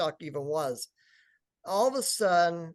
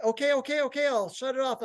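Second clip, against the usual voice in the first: higher-pitched mock placating reply; flustered compliance; 8 semitones higher, faster than usual. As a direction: imitate hurriedly giving in to a yelling partner. Retold reply to being yelled at.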